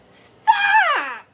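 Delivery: very high, loud shout. Acted. Splat shouting at the cats chasing his mouse. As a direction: yell at them to stop. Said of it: "cried Splat".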